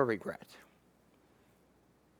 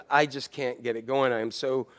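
weary frustrated worker; 6 semitones lower, 5 dB quieter than usual. Retold or imitated. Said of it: "people working from home said".

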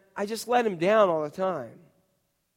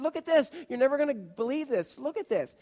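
excited; 7 semitones higher, 4 dB quieter than usual. Retold or imitated. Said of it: "saw how excited I was".

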